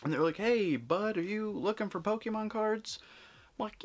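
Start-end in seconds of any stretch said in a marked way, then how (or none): 0.4-3.0 mock friendly scalper. Scalpers voiced in retold story.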